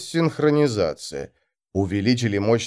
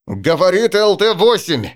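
urgent higher radio call; pilot over the radio; 7 semitones higher, 4 dB louder than usual. Acted. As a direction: cut in on the radio loudly. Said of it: "включился пилот второй машины".

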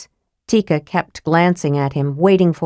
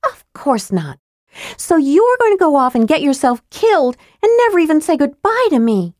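high, louder bitter reproach; bitter accusation; 10 semitones higher, 3 dB louder than usual. A narrator's reading. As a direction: throw the charge at him. Tika voiced, accusing.

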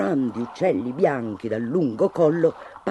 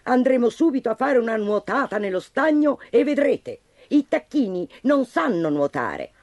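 shrill mother; 6 semitones higher than usual. Acted. Mother duck silencing the mocking chicks, high sharp cry. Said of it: "strillò la madre tagliando corto".

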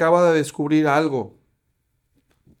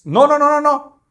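high, loud repeated no; resisting; 10 semitones higher, 9 dB louder than usual. Acted. Jesús holding back the shoving crowd, loud and high. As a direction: plant your feet and push back with your voice. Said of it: "todo lo que tuvo que resistir, dice".

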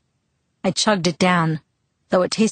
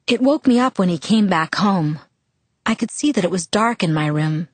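ordinary delivery, nothing marked

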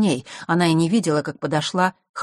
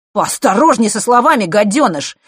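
higher, loud threatening retort; angry warning; 6 semitones higher, 8 dB louder than usual. A narrator's reading. Boy insulted by his rival, angry warning. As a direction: warn him sharply and loudly.

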